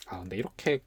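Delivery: quiet muttered aside; under breath. Real, not acted. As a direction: say it softly to yourself. Soft aside after sign-off.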